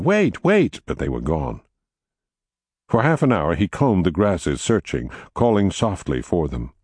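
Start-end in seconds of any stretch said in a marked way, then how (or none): 0.0-0.8 put-on high-pitched child's yell, moderate volume. A narrator's reading. Child running after fleeing rabbits, yelling. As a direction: call out high after them.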